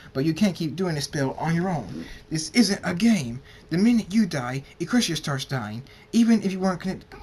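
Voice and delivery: heated, emphatic lecture; angry scolding. Acted. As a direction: lay down the law, loudly. Doctor scolds and forbids him.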